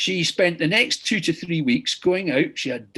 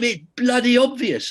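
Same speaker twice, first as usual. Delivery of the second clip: higher, louder mock shout of "It's bloody obvious"; retold shout; 8 semitones higher, 4 dB louder than usual. Angry patient retold shouting at her surgeon, mock shout. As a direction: throw it back like an angry patient, half-acted. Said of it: "And then she just shouted at me".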